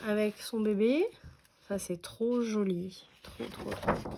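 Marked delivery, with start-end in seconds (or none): 3.2-4.1 breathless whisper of delight. Real, not acted. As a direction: whisper it as if too charmed to speak aloud. Gushing over a cute paper.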